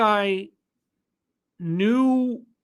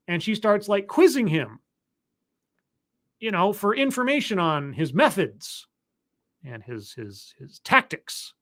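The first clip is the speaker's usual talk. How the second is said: ordinary delivery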